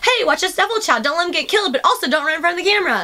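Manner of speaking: high-pitched, indignant retort; exasperated rant. Retold or imitated. Worker venting about impossible tasks from boss, exasperated rant. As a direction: rattle off the unfair demands in mock outrage. Ranting back at the screaming boss.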